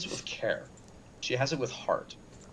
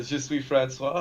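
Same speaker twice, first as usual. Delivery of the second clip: French line; 2 semitones lower, 6 dB quieter than usual. Acted. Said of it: "Uh, sorta".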